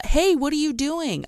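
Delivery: high calling-out imitation; retold yell. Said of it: "You yelled".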